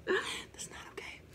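unvoiced, slow scared whisper. Real, not acted. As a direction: breathe it out, spooked. Reacting to a ghost's words.

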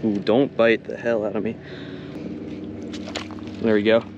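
ordinary delivery

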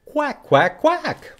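duck quacks. Shown as a demonstration. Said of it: "what sound a duck makes".

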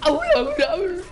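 high, loud panicked exclamation; alarmed cry. Real, not acted. Player reacting to trouble in the match, high loud alarm.

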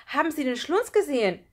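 loud, high, urgent question; worked-up boy asking for help; agitated question. A narrator's reading. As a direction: ask urgently, pitched high. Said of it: "fragte Lukas ganz aufgeregt".

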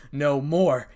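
usual speaking voice

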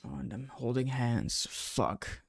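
soft, breathless whispered curse; overwhelmed under the breath; choked whisper. Real, not acted. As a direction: barely get the word out. Emotional at the ending.

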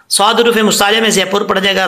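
ordinary delivery

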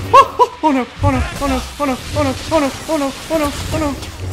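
high, frantic repeated oh no; panicked oh no. Real, not acted. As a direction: rattle it off in rising panic. Panicking while losing the fight.

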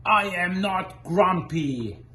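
slow, loud grumpy shout; angry shout. Acted. Jim shouts at everyone beating his chest, slow loud angry. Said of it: "Shouted Jim as he beat his chest".

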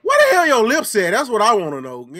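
loud, high disbelieving yell; outraged shout. Real, not acted. Yelling at the video about missing lips, loud disbelief. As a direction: call it out loudly in disbelief.

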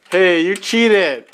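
ordinary delivery

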